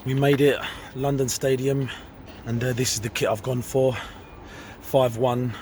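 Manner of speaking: breathless after running, excited; out of breath and loud with excitement. Real, not acted. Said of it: "I'm still out of breath from the run".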